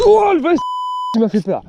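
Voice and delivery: sudden high startled yelp; startled cry. Real, not acted. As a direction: jump at the fright. Startled by big fish.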